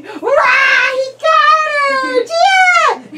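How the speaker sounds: high loud sung cheer; excited cheer. Real, not acted. Parent to baby after the catch, high loud excited cheer. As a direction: cheer like it's a big win.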